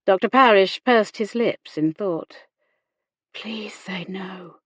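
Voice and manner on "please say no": slow, pleading whisper; silent plea. A narrator's reading. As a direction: whisper it like an unspoken prayer. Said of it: "Hannah silently pleaded".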